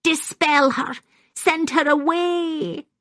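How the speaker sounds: put-on high-pitched old woman crying out; raging old woman. A narrator's reading. Maggie demands Constance be banished, raging high-pitched. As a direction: send the voice high, dragging out the last words.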